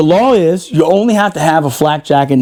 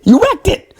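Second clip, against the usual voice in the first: squeaky self-scold. Retold or imitated. Scolding himself about the wrecked Humvee, squeaky high voice. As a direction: jump way up high in disbelief. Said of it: "scolding himself, pitch jumps high".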